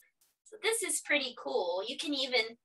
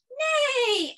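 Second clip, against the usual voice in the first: much higher neigh, mock horse; whinny it playfully; cow neighing; 14 semitones higher, 5 dB louder than usual. Cow neighs to the surprised farmer, high playful neigh. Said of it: "The cow said".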